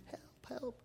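voiceless, whispered imitation of screaming; mock scream. Houseguest screaming from a nightmare, whispered mock scream. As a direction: scream for help under your breath. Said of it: "just screaming".

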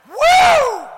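high, long, loud "woo"; loud whoop. Retold or imitated. Pastor reacting to news of the drug bust, loud whoop. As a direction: whoop it out at full voice.

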